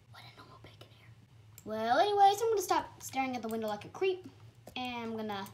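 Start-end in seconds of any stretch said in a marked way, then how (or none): 0.1-1.1 unvoiced whisper aside. Real, not acted. Gamer whispering about a player at the window, hushed. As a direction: breathe it out like a secret.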